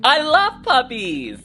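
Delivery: ordinary delivery, nothing marked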